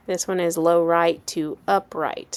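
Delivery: ordinary delivery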